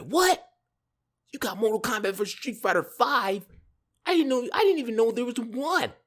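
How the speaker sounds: put-on high-pitched, incredulous kid reaction; astonished outburst, playing it up; retold amazement.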